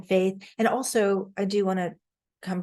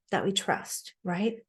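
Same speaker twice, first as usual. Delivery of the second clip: normal talking voice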